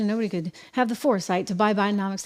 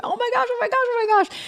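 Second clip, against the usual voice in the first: put-on much higher, squealing little girl's delight; excited squeal; faster than usual. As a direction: gush like a thrilled child in a huge suite. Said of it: "she's running around going".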